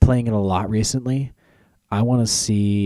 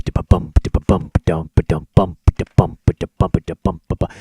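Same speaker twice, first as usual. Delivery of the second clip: unvoiced mock drum beat, bump da bump; beatbox the jungle drums; 3 semitones lower than usual. Setting a Donkey Kong scene, mock drum beat. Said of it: "You start hearing the bump".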